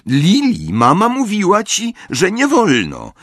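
high-pitched bossy telling-off; indignant high reprimand; scolding child. A narrator's reading. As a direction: scold your sister, voice pitched well up. Hands on hips, scolding her sister.

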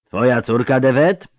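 understated roar of a bass character; lightly voiced indignant boomer; booming bass roar. A narrator's reading. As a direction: challenge him with a mild roar. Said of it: "zaryczał ten basowy dudniący".

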